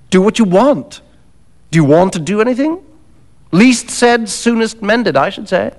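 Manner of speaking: a touch of dismissive impatience; Macfarlane, brisk and dismissive. Acted. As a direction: shrug it off with cool advice.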